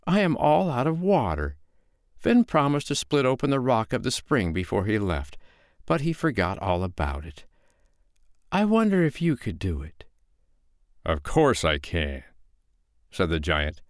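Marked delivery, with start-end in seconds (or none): 0.1-10.0 higher, lilting woman's voice; storybook woman feigning helplessness; wheedling foster mother. Acted. Foster mother sets giant a task. 11.0-12.2 low, slow giant voice; heavy storybook giant; boastful giant. Acted.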